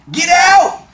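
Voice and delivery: high, loud shouted order; urgent yell. A narrator's reading. Boy shoving his friend to the window, urgent yell. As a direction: yell it to get them moving.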